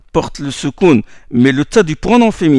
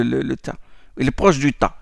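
ordinary delivery

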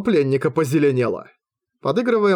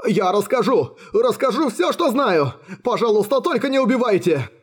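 high, frantic begging; terrified captive giving in; panicked pleading; 7 semitones higher than usual. A narrator's reading. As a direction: plead for your life, voice pushed up. Captive begs not to be killed.

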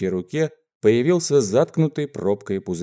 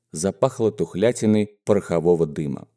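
usual speaking voice

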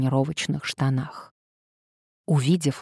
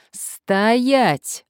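higher, louder drawn-out bark; barked order; 7 semitones higher, 5 dB louder than usual. A narrator's reading. Nadezhda to a man about to run, barking. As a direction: bark it to halt someone fleeing. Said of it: "рявкнула Надежда".

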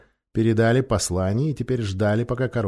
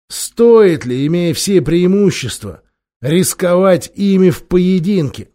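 loud, higher angry scolding; angry king; 7 semitones higher, 8 dB louder than usual. A narrator's reading. Miraz rebuking Glozelle for doubting his courage, loud anger. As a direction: berate them for cowardly advice.

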